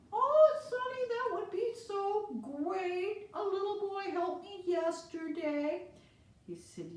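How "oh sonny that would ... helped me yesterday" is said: put-on very high, slow old lady voice; sweet old lady. Old lady thanks the boy, very high and sweet. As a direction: coo at the helpful boy.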